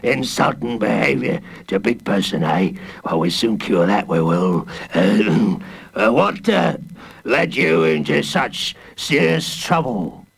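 lightly voiced low, menacing warden; a growling jailer sneering at a boy; gruff villain voice. A narrator's reading. Warden sneering at Jacob Tutu in gruff voice. Hooded Fang character voice.

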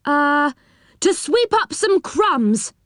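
high, slow, hesitant excuse; untruthful. A narrator's reading. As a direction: make up a fib on the spot. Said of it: "quite untruthfully".

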